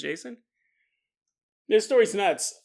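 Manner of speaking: ordinary talk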